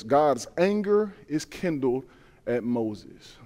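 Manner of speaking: solemn hush. Real, not acted. Preacher solemnly stressing scripture.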